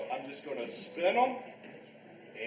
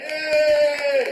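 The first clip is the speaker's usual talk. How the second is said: high, drawn-out cheer; loud cheer; 8 semitones higher, 13 dB louder than usual. Real, not acted. Class cheering on the good guy, loud cheer. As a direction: cheer for the student at full voice.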